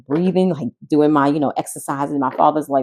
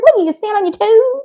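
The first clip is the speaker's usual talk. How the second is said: coaxing; 4 dB louder than usual. Retold or imitated. Mock coaxing friend.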